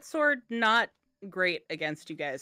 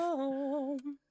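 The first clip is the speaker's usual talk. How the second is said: sing-song villain greeting; theatrical witchy greeting; Mother Gothel; 6 semitones higher than usual. Acted. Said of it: "Mother Gothel you were channeling with your "I'm home".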